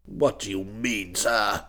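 low, soft indignation; restrained outrage from an old duke, pitched very low; indignant old boy. A narrator's reading. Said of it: "cried the old boy, getting purple".

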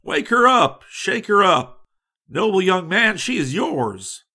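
high, loud, eager cry that settles at the end; enthusiastic. A narrator's reading. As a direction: burst out with sudden eager approval. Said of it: "Old Huss enthusiastically".

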